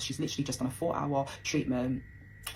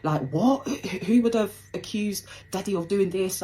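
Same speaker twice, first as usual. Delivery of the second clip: imitating a panicked mum, building higher and louder; retold panic; 5 semitones higher, 5 dB louder than usual. Mum on the phone hearing of dad's arrest, imitated panic. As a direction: act out her stunned, stumbling questions. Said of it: "and she's panicking".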